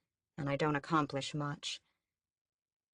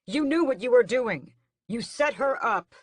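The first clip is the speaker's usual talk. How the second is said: high, loud accusing shout; furious accusation; 7 semitones higher, 7 dB louder than usual. A narrator's reading. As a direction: hurl the blame at her. Raoul accusing the queen.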